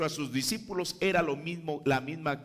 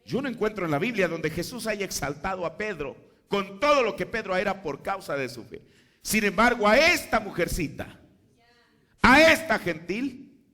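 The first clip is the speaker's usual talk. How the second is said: higher and louder, building fervor; fervent preaching; 6 semitones higher, 6 dB louder than usual. Acted. Preacher exalting the humble gentile woman, fervent rising preaching. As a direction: lift the congregation toward the point.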